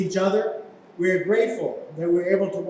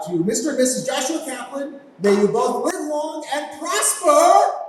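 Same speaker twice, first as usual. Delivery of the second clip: toast; 8 semitones higher, 3 dB louder than usual. Real, not acted. Toast with raised glass.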